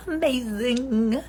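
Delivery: ordinary delivery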